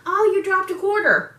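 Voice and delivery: high, loud chirpy bystander voice; imitating others. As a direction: chirp it like a helpful stranger. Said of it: "But people will be like".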